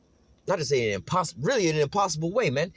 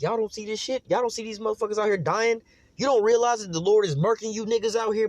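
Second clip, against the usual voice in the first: pitched way up, incredulous calling out; exasperated outcry; 8 semitones higher than usual. Retold or imitated. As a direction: cry out in disbelief at blind people. Preacher voicing exasperated believers.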